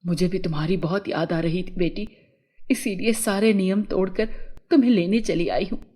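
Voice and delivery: low mother's voice, comforting; mother fairy. A narrator's reading. Mother reunites with her daughter, low comforting voice. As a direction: answer well down in pitch, as the mother. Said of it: "बहादुर परी ने कहा".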